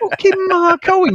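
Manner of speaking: loud, high, drawn-out outrage; fuming. Retold or imitated. Lad retelling being compared to a pop singer, fuming. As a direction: retell it as exasperated disbelief. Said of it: "I was fucking fuming".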